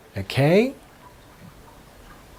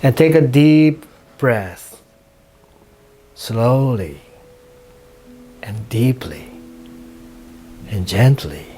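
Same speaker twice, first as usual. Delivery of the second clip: guided hypnosis. Acted. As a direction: guide gently, stretching out the key words.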